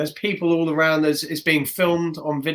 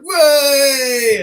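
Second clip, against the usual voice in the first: much higher, louder "Way!" shout; crowd cheer; 12 semitones higher, 12 dB louder than usual. Retold or imitated. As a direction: cheer like a wedding crowd amazed.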